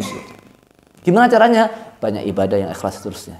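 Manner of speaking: ordinary talk, nothing marked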